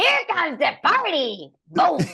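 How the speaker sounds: high, slow, loud party announcement; hammed-up party shout; Reyes voice line. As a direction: hype it up like a game character cheering. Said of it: "Reyes would say".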